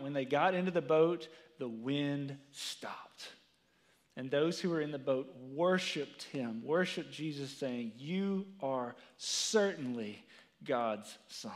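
low, soft and reverent, the words weighed; solemn scripture reading. Acted. Preacher reading Jesus' words to congregation, hushed and solemn. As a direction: read it like holy writ. Reads Matthew verse aloud.

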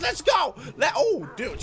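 usual speaking voice

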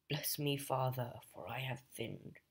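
a soft, hushed confession line, fading away; mock confession. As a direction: murmur it like you're in the confessional. Deadpool quoting a confession.

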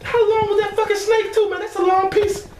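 squealing amazement; high astonished shrieking. Real, not acted. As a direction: shriek at the length of the snake. Seeing long snake half.

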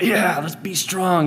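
a touch of pumped-up hype; lightly acted rallying enthusiasm; mock fired-up. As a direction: imitate getting fired up to be strong. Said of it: "gets me emotionally fired up".

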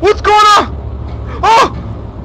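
very high, loud cries of real panic; panicked screaming. Real, not acted. Player shrieking that someone's coming, terrified. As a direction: scream the warning, easing off at the very end. Game threat approaching up stairs.

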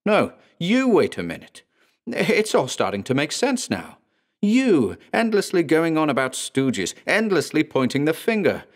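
high, forceful cut-in; heated accusation. Acted. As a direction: jab back hard, accusing. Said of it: "interrupted by Blackgarden".

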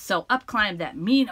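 plain reading voice

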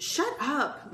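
ordinary delivery, nothing marked

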